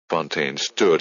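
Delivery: ordinary delivery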